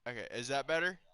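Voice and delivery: quiet. Real, not acted. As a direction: say it softly, barely audible. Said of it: "I'm gonna adjust my microphone level".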